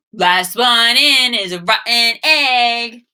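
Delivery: a hammed-up kid's taunt, slow and loud; mock yell. Wally bursting in, shouting a slow playful taunt. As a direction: tease like a boisterous boy. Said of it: "Wally shouted".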